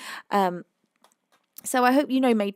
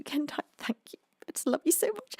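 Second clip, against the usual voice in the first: choked, high whisper near tears; tearful whisper; 6 semitones higher, 6 dB quieter, faster than usual. Real, not acted. Streamer whispers love to her friend Kentai, close to tears. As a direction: barely get the words out.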